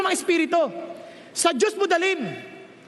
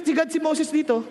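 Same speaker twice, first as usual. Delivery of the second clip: ordinary delivery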